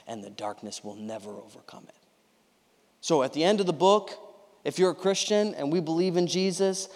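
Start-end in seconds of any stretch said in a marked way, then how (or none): 0.1-1.9 hushed, breathless whisper, much softer. Acted. Preacher hushing the room over the darkness, whispered. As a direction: drop to an unvoiced whisper for weight. Quiet climax of scripture point.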